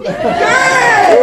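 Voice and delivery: high, loud mock cheer; excited cheer. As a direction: throw up a gleeful cheer. Big cheer, shout.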